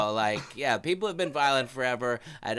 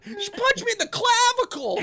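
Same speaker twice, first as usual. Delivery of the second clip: mock whiny voice; 3 dB louder than usual. Mock complaint.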